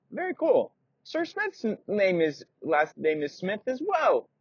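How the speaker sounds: put-on high, wide-eyed kid; a little boy impressed; Timothy reacts to the blacksmith's name; Timothy amazed. Timothy speaks.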